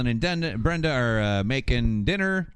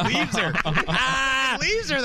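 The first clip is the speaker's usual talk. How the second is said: much higher, helpless real laughter; laughing hard; 13 semitones higher, slower than usual. Real, not acted. Host cracks up at a character walking off, laughing hard. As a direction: squeal it out through the laughs.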